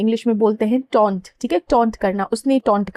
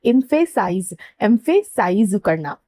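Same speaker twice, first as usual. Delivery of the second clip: slow clear model word; much slower than usual. Shown as a demonstration. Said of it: "as a word बोलेंगे हम emphasize".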